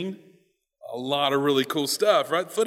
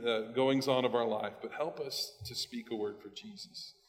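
low, hushed closing prayer; quiet, subdued prayer before the talk; 4 semitones lower, 7 dB quieter than usual. Real, not acted. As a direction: pray softly and low, easing into amen.

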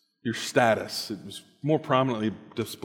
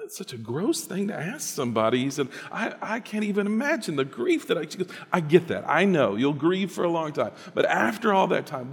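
lively joke-telling voice; joke storytelling; 5 semitones higher than usual. Acted. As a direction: spin the joke for the crowd.

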